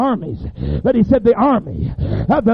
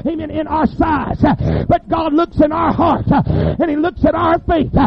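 preaching loud and climbing, building to shouts; full-force preaching; 4 semitones higher, 4 dB louder than usual. Acted. Preacher rousing the congregation with a full-force sermon. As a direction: hammer the sermon home louder and louder.

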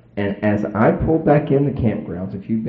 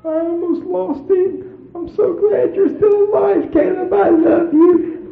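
very high, drawn-out tearful wail; imitating a weepy, relieved mother; mock crying; 4 dB louder, slower than usual. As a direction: gush through put-on tears. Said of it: "she was crying day after day".

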